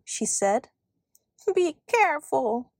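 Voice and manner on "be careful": mildly anxious elf warning; higher-pitched, worried little cry. A narrator's reading. Elf warning girl pulling out a thorn, crying out. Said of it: "the elf cried".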